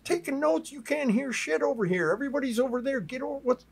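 high-pitched, acted-out disbelief; retold incredulous urging; incredulous scoff. Stranger scoffs and urges him over.

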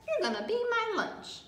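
high braying donkey voice; donkey's threat. A narrator's reading. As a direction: swoop way up high on the playful threat. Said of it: "looked at the pancake and brayed".

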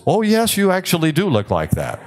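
high, lilting mock class chorus; mock teasing class. Lecturer voicing the class correcting a resident, high and teasing. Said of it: "the class says".

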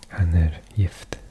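normal talking voice

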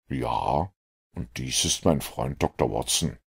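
a dry detective mildly regretting his deduction. A narrator's reading. Holmes to Mortimer, low and rueful.

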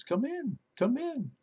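high, soft squawky "come in"; parrot voice. Acted. Parrot calling the pastor in from the kitchen, squawky. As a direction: call it out like a parrot.

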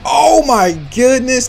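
high, drawn-out cry of shock; shocked exclamation. Real, not acted. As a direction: stretch it high, truly stunned. Hit by a surprise move.

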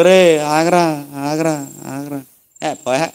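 put-on slow, fading call of a sick old man; weak bedridden call. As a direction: call after them as your voice dies away. Bedridden elder calling after grandchildren.

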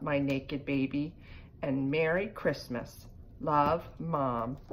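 usual speaking voice

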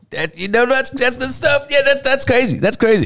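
high-pitched hype woman impression; hype-up laugher. Imitating the girl who laughs a lot, high gushing hype. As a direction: imitate her squealing along in a high voice. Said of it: "she's just like".

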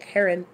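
"heron" said HAIR-un; short second syllable in "heron", HAIR-un; their HAIR-un pronunciation. Retold or imitated. Showing how YouTube says it, "heron" as HAIR-un. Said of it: "exactly how they say it".

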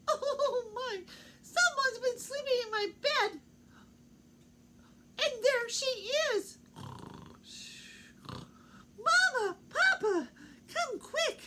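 very high, loud little bear calling; Baby Bear's voice. Acted. Baby Bear calling his parents to come quick, high squeal. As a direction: squeal for mama and papa to hurry. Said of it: "and says".

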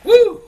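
very high, loud "woo"; whoop. Real, not acted. Cook cheering before a dish for his father, high loud whoop. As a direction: let out a piercing whoop. Whoops while cooking for viewers.

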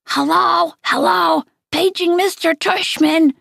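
high, put-on old lady announcer; fake old lady voice. Dad joking to Augie, paging the principal in a high old lady voice. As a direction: squeak it out like a dotty old lady on the loudspeaker. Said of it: "He was using a fake high old lady voice".

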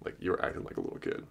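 soft breathy teasing whisper. Shown as a demonstration. Man teasing a distant woman, playful whisper. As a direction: tease her in a hushed whisper. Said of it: "And you're like".

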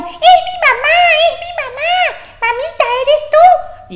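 put-on high, squeaky kid voices; excited little goats. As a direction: squeal it like eager little kids. Kids answer the door.

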